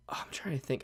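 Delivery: soft unvoiced whisper. Real, not acted. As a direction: breathe the words without voice. Sudden whisper while recalling.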